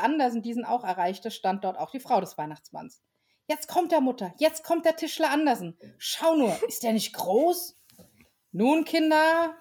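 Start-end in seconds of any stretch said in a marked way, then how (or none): 3.5-7.8 high, eager little voice; a thrilled small child pointing; high child voice full of wonder; excited child. Acted. Elf child announces Andersen. 8.5-9.6 slow, drawn-out motherly call; slow mother hushing children. Acted. Mother calms her children.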